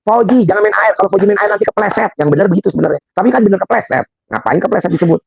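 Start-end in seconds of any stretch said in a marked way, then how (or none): 0.1-2.1 put-on higher-pitched warning to a child; parental warning. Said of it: "Harusnya yang benar ngomongnya gimana".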